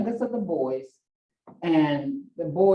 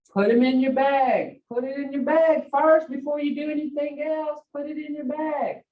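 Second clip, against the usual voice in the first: commanding grandmother; 6 semitones higher than usual. Retold or imitated. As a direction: insist he pack the bag first.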